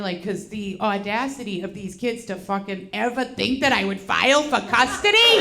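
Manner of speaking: ordinary delivery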